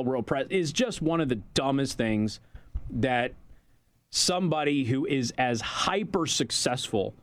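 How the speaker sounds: normal talking voice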